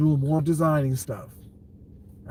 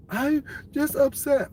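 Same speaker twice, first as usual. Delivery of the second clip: mock whiny; 10 semitones higher, slower than usual. Mocking emotional people.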